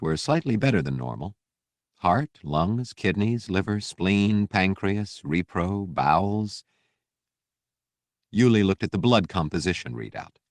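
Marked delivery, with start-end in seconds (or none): none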